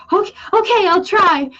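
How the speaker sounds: high, sweet and eager; eager little voice. Retold or imitated. Friend promising to try being angry, high and sweet. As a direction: chirp it like an eager kid trying her best. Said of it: "And she goes".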